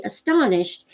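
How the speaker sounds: plain reading voice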